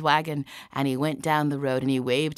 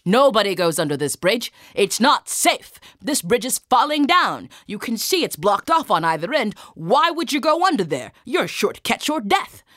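loud, higher, emphatic guard warning; indignant, forceful sentry; stern soldier; 6 semitones higher, 7 dB louder than usual. Acted. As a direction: lay down the law loudly. Soldier forbids the bridge.

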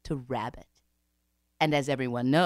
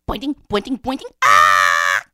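quick high boings punctuated by loud screams; bouncing and screaming; 3 semitones higher, 13 dB louder than usual. Acted. Terrified Rabbit flees the hole, boinging and screaming.

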